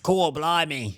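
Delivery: Australian accent put on for a British word; fake Australian accent. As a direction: lay on an Australian twang. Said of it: "No, that's Australian".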